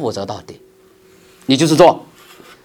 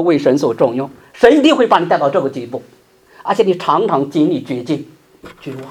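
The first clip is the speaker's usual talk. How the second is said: normal talking voice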